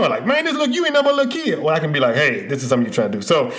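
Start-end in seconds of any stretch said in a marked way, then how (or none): 0.2-1.6 defensive retort. Retold or imitated. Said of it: "two ways you respond". 2.2-3.2 calm acceptance. Retold or imitated. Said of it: "two ways you respond".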